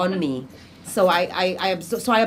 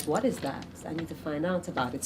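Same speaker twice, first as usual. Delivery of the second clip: curious to herself; 10 dB quieter than usual. Retold or imitated. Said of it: "I'll be like".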